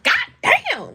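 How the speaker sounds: high-pitched astonished exclamation; shocked reaction. Retold or imitated. Wife reacting to husband's handcuff story, shocked. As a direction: cry it out high in disbelief.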